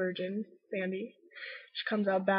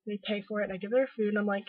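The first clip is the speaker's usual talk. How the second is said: normal talking voice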